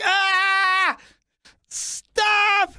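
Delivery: high yelped protest; mock yelp. Yelp at exam.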